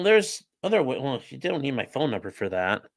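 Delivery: ordinary talk, nothing marked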